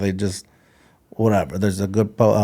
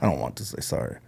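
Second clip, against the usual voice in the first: grudging mutter; 8 semitones lower, 10 dB quieter than usual. Retold or imitated. Inner grudging thought while apologizing.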